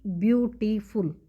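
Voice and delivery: "Beautiful" broken into three beats, ब्यू-टी-फुल; syllable by syllable. Shown as a demonstration. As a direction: pause between each syllable of "beautiful". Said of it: "रुक रुक के बोलते हैं".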